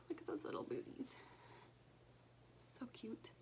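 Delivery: fond quick whisper. Real, not acted. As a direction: coo it in a whisper. Cooing over stitched booties.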